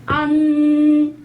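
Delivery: 안 drawn out long, high and loud; slow 안 with a nasal end. Shown as a demonstration. Teacher modelling a long nasal 안. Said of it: "이 아가 이쪽에서 나야".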